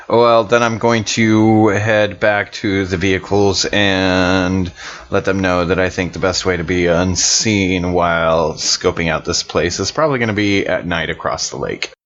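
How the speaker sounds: normal talking voice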